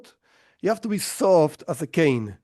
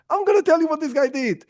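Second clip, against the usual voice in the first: high, fast, laughing through the threat; gleefully threatening to expose him; chuckling mock accusation; laughing mockery; 11 semitones higher, faster than usual. Ugly man mocking the rabbi.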